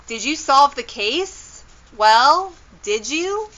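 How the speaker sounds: high, insistent rising questions; demanding questioning. A narrator's reading. Kendra presses the detectives about the case, insistent and high.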